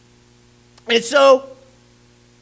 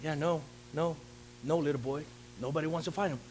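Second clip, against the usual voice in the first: put-on much lower and softer, brushing the boy off; king dismissing the little boy, low and soft; dismissive king; 8 semitones lower, 14 dB quieter, faster than usual. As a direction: shrug him off like a weary king. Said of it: "the king was like".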